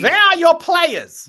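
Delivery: high, slow mock German officer; bad German accent. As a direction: ham up a German guard barking the question. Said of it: "Poor German accents".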